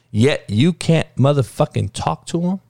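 ordinary talk, nothing marked

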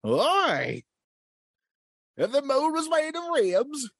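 high-pitched sportscaster impression, stretched vowels; Harry Caray. Ghost sportscaster asking about the moon, high hammed-up holler. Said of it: "The ghost of Harry Carey".

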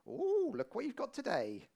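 high sing-song 'ooh' with mock delight; playful mock cashier patter; chirpy till worker. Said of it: "You'll be there on your tills going".

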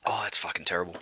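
hushed disgusted whisper. Real, not acted. Tasting the dead father's beer, real whisper. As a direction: breathe it out in a whisper.

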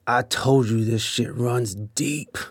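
lightly voiced drunk on the floor, sagging; drunk friend.

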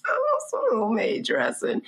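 very high, pleading whine; high whiny craving voice. Retold or imitated. Craving voice, quoting herself.

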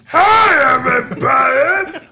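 high, loud yelling puppet shark. Pretend. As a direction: yell it like a toothy puppet shark. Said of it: "that's every shark voice I did".

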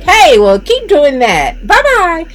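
cheery on-air reply. Retold or imitated. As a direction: brush off the question with a big on-air smile. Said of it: "I'd say".

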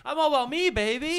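high, loud showy catchphrase; sing-song brag; hammed-up catchphrase. Intro drop singing catchphrase.

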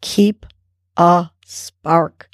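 measured, with a pause after "keep"; slow exhaled mantra. Shown as a demonstration. Coach repeats the affirmation on the exhale, slow mantra. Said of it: "On the exhale".

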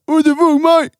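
very high, hammed-up Australian squawk; mock Aussie accent. Punched kangaroo confronting the man, high mock Aussie accent. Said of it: "I can't do an Aussie".

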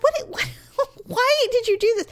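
high, laughing mock-hostile outburst. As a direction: blurt it out laughing in disbelief. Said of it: "I was so hostile".

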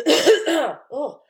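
sudden choking cough. Real, not acted. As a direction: cough and choke mid-sentence. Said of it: "sorry, choking".

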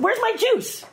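very high put-on voice; high mock demand. Actress repeats her juice line for the host, high-pitched. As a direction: squeak the question out. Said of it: "so I said".

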